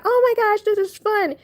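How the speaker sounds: high-pitched mock delight; happy squeal. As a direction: gush as if it's the best fun ever. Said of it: "not in a happy way. It's not".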